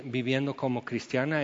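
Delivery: ordinary talk, nothing marked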